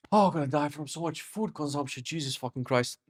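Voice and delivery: groaning. Real, not acted. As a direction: moan it like overstuffed. Groaning at too much food.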